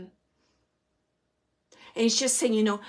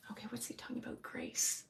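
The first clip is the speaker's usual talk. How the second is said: breathy whisper to herself, much softer; whispered aside; 3 semitones higher, 15 dB quieter, faster than usual. Real, not acted. Psychic reader whispering to herself about the message. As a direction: whisper the question under your breath. Muttering to herself mid-reading.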